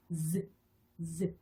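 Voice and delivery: Z as a buzzing "zzeu", not the letter name; voiced Z sound. Shown as a demonstration. Teacher modelling the voiced partner of S, buzzed Z. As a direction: buzz Z with tongue near the gum ridge. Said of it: "유성음으로 Z라고 발음을 하면".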